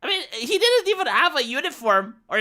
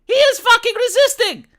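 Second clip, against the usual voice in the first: exasperated outburst; 12 semitones higher, 6 dB louder than usual. Real, not acted. Exasperated at suspect on video.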